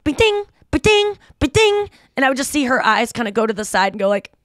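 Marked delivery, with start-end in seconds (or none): 0.0-1.9 high, bright ding-ding of a game alert; imitated alert chime. Mocking her son's Minecraft alerts, high chiming imitation. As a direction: mimic the Minecraft notification. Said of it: "and be like".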